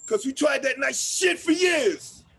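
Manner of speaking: loud, slowed and forceful; angry shout. Acted. To the man telling him to calm down, angry shout. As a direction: hammer each word in anger.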